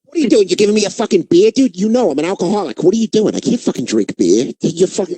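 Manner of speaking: imitated fast, agitated rebuke; lightly hammed-up exasperated protest; mock indignant. Alcoholic comic handed a beer, mock indignant protest. Acting Bobby Kelly refusing beer.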